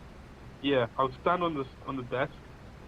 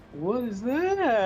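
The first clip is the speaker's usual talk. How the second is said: gasping, slow and high; shocked gasp; 7 semitones higher, much slower than usual. Real, not acted. Player sees knight appear.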